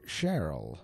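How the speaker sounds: ordinary delivery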